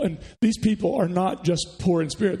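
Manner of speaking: ordinary talk, nothing marked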